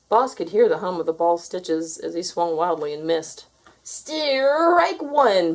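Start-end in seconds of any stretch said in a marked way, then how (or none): 3.8-5.5 high, loud umpire call; umpire bellowing. A narrator's reading. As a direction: bellow the call across the yard. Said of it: "bellowed the umpire".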